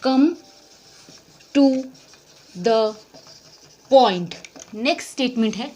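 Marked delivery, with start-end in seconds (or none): 0.1-4.3 each word apart, last one loud; slow dictation. Shown as a demonstration. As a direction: drill it word by word. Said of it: "तो क्या बोलोगे?". (हर शब्द अलग, ज़ोर से)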